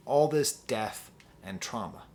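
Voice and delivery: usual speaking voice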